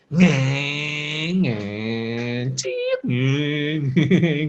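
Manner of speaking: drawn-out engine hum with a skid; vehicle noises. Acted. Boy playing instead of chatting, imitated engine noises. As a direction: imitate a boy zooming a toy car.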